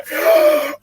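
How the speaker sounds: sharp acted gasp of realization; sudden gasp. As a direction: gasp as if jolted awake. Acts out waking with sudden realization.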